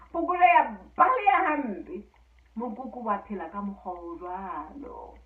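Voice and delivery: telling a folk tale aloud, lively and even; a storyteller sharing a fairy tale with listeners; story narration. A narrator's reading. Storyteller telling Basotho fairy tale to listeners, lively narration.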